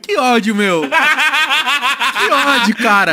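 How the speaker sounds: mock furious paulistano; angry São Paulo accent. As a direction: act like an annoyed São Paulo guy. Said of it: "Um paulistano puto".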